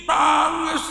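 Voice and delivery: ordinary talk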